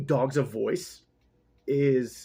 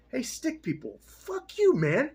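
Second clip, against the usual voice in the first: mocking taunt; 11 semitones higher, slower than usual. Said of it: "They're like".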